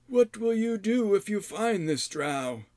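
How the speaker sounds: high, slow probing hermit; challenging old man. Acted. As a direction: test him like a stern old man. Rydoth challenged.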